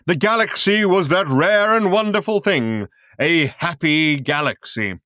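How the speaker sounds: booming, high grand showman voice; grand announcer. A narrator's reading. Film narrator booms at the audience, grand and loud. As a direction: proclaim it like a cosmic showman.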